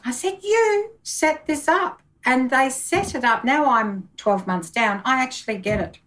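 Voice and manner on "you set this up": much higher, slow indignant accusation; accusing anger. Retold or imitated. Accusing her IT people, slow high indignation. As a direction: drag out the blame at them. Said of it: "I was, I was angry".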